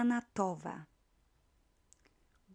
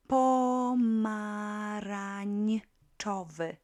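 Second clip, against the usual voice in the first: Pomarańczowy stretched out slowly, syllable by syllable; slow teaching demo; 6 dB louder, much slower than usual. Shown as a demonstration. Teacher spells out a long adjective for learners, slowly. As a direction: drag Pomarańczowy out so every syllable is heard. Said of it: "Quite long, uh, adjective".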